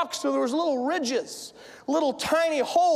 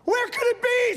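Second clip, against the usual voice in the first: higher-pitched frantic searcher; frantic searching; 6 semitones higher, 3 dB louder than usual. Acted. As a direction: act out a panicked hunt for something lost. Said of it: "she starts searching frantically".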